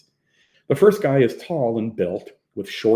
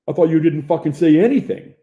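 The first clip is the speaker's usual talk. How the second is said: loud, high, goading accusation; aggressive comeback; 7 semitones higher, 8 dB louder than usual. A narrator's reading. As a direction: throw his words back at him, hostile. Bully escalates quarrel with Hopper.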